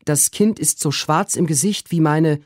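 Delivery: high, hurried maid's voice; the flustered maid reporting; high anxious servant voice; Susanna, urgent. A narrator's reading. Susanna's voice again.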